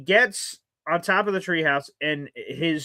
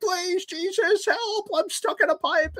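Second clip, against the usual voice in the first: much higher, slow mock wail; panicking brother; slower than usual. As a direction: whimper it like a stuck big kid. Brother voiced panicking in pipe.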